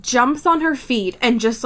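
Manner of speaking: ordinary delivery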